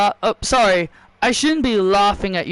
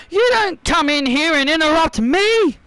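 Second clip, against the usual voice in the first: furious wrestler yelling at an interrupter; angry outburst; 6 semitones higher than usual. Acted. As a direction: put the intruder in her place.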